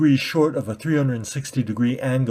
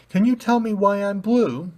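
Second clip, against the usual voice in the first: put-on high, childlike asking; innocent question; 10 semitones higher than usual. Blue ball asking red ball why it's blue, innocent high. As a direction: ask like a puzzled little kid.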